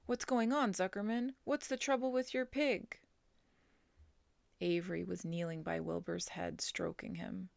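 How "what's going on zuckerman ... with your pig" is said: higher, louder urgent questions; announcer rattled by the fainting pig; alarmed announcer. A narrator's reading. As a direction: press for answers, worried. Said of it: "asked the loudspeaker".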